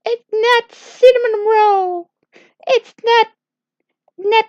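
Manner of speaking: high, distressed fairy voice; high, upset girlfriend voice. Acted. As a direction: squeak it out like she's upset and can't find the word. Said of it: "But she was like".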